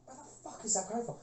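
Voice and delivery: whispered confusion; hushed. Retold or imitated. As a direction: hush it in wonder. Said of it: "I was like".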